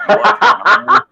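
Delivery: loud, high squawk of disbelief; hammed-up shock, blasted out; mock surprise. Recapper playing Tony hearing the robot pitch, mock surprise.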